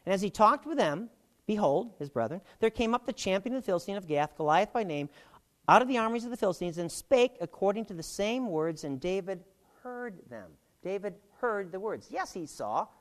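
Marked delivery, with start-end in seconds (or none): 0.1-10.5 raised, declaiming Bible reading voice; scripture reading. Acted. Teacher reading Jesse's words to the class, declaiming. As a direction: proclaim the verse to the room.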